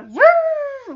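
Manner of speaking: high, loud whistling woo; imitated whistle woo. Shown as a demonstration. Explaining the toy ring's noise, high loud woo. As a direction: imitate a ring you blow into. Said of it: "like, type of sound".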